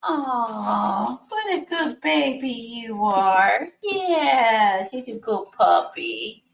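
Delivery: doggy voice. Real, not acted. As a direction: gush over the puppy, slowly. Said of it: "My doggy voice".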